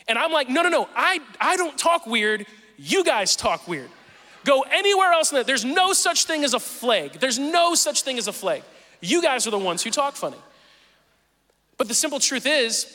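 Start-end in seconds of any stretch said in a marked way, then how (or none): 0.5-10.4 higher-pitched, insistent schoolkid protest; indignant protest. Retold or imitated. Seventh grader answering classmates who mock the accent, indignant protest.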